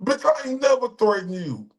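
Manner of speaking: heated rant. Real, not acted. Streamer ranting at the woman who accused him, indignant. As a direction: fire back at her, voice up high.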